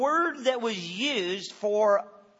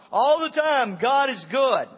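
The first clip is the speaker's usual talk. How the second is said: higher, louder stock reply; churchy call-back; 4 semitones higher, 6 dB louder than usual. Retold or imitated. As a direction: belt back the church response like a congregation member.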